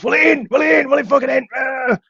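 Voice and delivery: high-pitched garbled London ranting; mock kicking off. Bloke kicking off at the driver, mock ranting. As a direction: imitate an irate, incoherent rant. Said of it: "he started kicking off".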